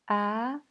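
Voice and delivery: "A" rising to the end; second tone. Shown as a demonstration. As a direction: let "A" climb from mid pitch. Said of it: "Les quatre tons".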